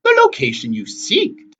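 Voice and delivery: put-on slow, high, loud old sister; boasting hag. A narrator's reading. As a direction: add it slowly, high and loud. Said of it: "Tempest added".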